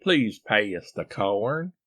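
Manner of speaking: slow Southern accent, vowels stretched in two; Southern drawl. Shown as a demonstration. Husband demonstrating Southern speech, slow drawl. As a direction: draw out PAY US into a Southern drawl. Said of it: "in Southern".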